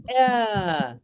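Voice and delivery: "a" held long as an open aah; long a vowel. Shown as a demonstration. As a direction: stretch "a" out for two counts. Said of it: "We pronounce alif as a".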